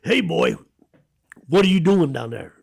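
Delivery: loud gruff call across the store; a big shopkeeper shouting down the aisle; calling out gruffly. Retold or imitated. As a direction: yell at a kid caught in the act. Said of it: "I hear this voice in the background".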